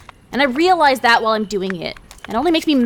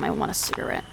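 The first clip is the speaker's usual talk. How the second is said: tired whisper; 8 semitones lower, 10 dB quieter, faster than usual. Acted. Tired craving.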